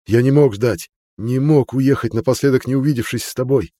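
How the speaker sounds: pleading. A narrator's reading. Pleading confession to the girl.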